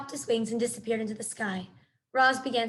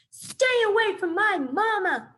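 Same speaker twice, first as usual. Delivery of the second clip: high, loud, slow protective yell; gosling shielding his mother from bears; fierce cry; 9 semitones higher, 5 dB louder, slower than usual. A narrator's reading. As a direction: scream it while swooping in. Gosling dives to defend mother.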